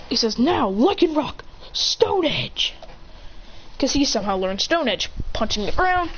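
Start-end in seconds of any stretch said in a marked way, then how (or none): 0.4-2.8 high, drawn-out move call; battle command. Acted. Trainer ordering Lycanroc's attack, high drawn-out battle call. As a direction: stretch out the attack's name.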